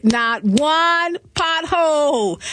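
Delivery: slow with "one" stressed. Real, not acted. Host boasting to listeners, slow and stressed. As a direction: drag out "not one pothole" for emphasis. Proud, slowed emphasis.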